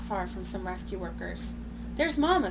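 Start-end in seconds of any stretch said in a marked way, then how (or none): 1.9-2.5 put-on high, loud child's cry; excited cry. A narrator's reading. Baby gorilla spotting her mother, high excited cry. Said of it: "Kudzu cries".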